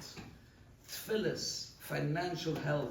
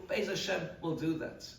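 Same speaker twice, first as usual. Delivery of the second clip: normal talking voice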